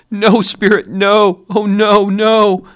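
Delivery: put-on terrified old man refusing his fate; anguished cries. A narrator's reading. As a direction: scream it in horror. Scrooge sees his own grave.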